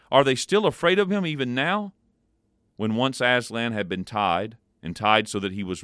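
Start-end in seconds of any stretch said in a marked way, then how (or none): 0.1-1.9 high, loud tearful question; sobbing question. A narrator's reading. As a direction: ask it through tears. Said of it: "sobbed Susan".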